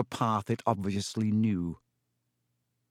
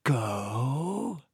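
long drawn-out questioning creature voice; slow; 4 dB quieter than usual. A narrator's reading. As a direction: stretch the word slowly, menacing spider. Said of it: "said Aragog slowly".

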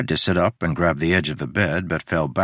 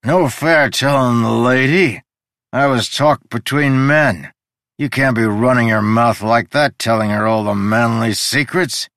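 put-on drunk scolding; 5 semitones higher, 6 dB louder than usual. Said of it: "Udo was drunk".

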